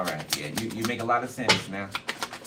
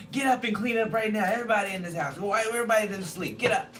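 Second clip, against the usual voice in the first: mock bossy grandma; 9 semitones higher than usual. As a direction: yell the house awake as grandma. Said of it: "Waking everybody up".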